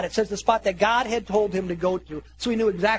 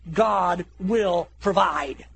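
very slow, each word drawn out; a firm declaration, word by word; declaring out loud; much slower than usual. Acted. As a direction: stretch out every word with conviction. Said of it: "but I think out loud, he's saying".

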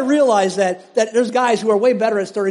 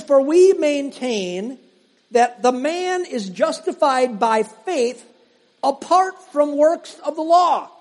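raised, measured reading of Paul; preacher reading scripture aloud; 4 semitones higher, slower than usual. Acted. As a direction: proclaim the verse slowly.

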